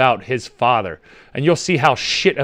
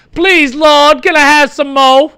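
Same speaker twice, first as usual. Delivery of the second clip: high, loud, hammed-up child's plea; begging orphan kid; 10 semitones higher, 5 dB louder, slower than usual. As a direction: plead like a hungry orphan in a play. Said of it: "it's like a kid at a".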